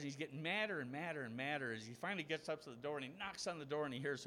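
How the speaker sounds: usual speaking voice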